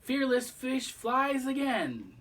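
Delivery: high, slow and triumphant; merry. Acted. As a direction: proclaim it with glee. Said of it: "she called merrily".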